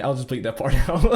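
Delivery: ordinary talk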